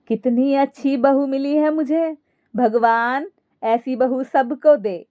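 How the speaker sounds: ordinary delivery, nothing marked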